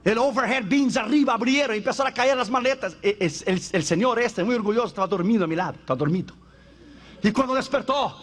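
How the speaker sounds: ordinary talk, nothing marked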